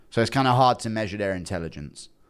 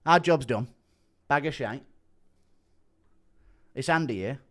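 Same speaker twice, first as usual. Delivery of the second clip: lightly imitated fighter's voice; terse catchphrases in a mimicked voice; Andy Aspinall impression. Streamer echoing fighter's voice message, lightly impersonated. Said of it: "has heard my Andy Aspinall impression".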